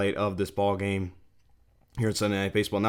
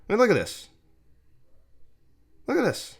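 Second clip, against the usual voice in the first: high-pitched, astonished disbelief; incredulous outburst; 11 semitones higher than usual. Real, not acted. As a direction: point at the replay in high disbelief. Incredulous at second bad call.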